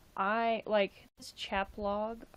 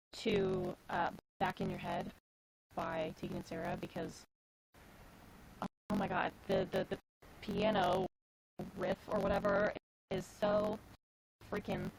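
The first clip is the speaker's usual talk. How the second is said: glitchy robot voice over a bad connection; choppy robotic stream audio. Real, not acted. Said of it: "why do I sound like a robot".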